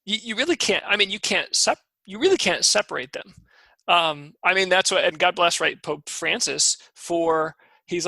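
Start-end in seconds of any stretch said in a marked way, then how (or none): none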